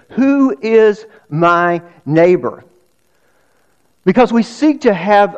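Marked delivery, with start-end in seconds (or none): 0.1-2.6 slow emphatic question. Acted. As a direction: stretch "Who is my neighbor?" out slowly, leaning on "who". Preacher repeats key question.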